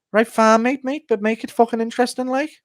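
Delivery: high-pitched, exasperated Darren Till voice; Till impression. Till fed up with Ortega's stories on a fishing trip, high exasperated impression. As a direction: rant high and fed up, threatening to quit. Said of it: "Ortega and Till on a fishing trip impression".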